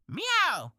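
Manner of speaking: bright falling goodbye meow; cute cartoon kitty meow; cat meow. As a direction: meow a cheerful farewell.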